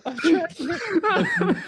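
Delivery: high laughing attempt. Real, not acted. Giving up on the accent while laughing. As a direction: crack up mid-try. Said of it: "I can't".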